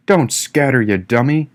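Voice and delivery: usual speaking voice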